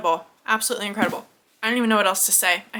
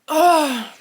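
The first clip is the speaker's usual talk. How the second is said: a loud, pained groan of frustration; frustrated ugh; 6 semitones higher, 5 dB louder than usual. Real, not acted. Reviewer groaning over a heartbreaking book, loud pained ugh. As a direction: let the ugh out loud and high.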